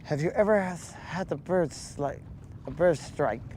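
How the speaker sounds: a faint lisping sloth impression; Sid the Sloth voice. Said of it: "that's a terrible Sid the Sloth voice".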